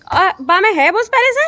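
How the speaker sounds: loud, high shouted question; calling out. Real, not acted. Asking family from afar whether fodder is there, loud call. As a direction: call it over the distance.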